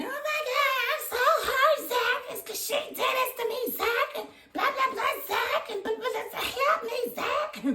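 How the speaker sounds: high whiny mocking voice; hammed-up whiny damsel; mocking Deja. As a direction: mimic her as a needy, whining girl. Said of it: "I just wanna talk about Deja".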